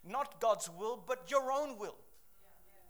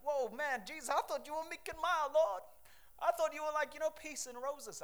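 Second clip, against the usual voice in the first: higher, faster, complaining churchgoer; mock whiny believer; 5 semitones higher than usual. Preacher mimicking a comfy believer, mock whine. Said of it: "It's like".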